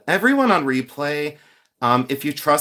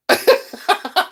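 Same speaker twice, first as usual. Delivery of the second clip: high burst of laughter; laughing. Real, not acted. Streamer cracking up at her own joke, high laugh.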